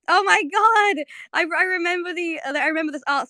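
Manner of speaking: high, loud burst of excitement; excited recognition. Real, not acted. Spotting remembered fan art, real excited outburst. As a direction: squeal it out, then ease down.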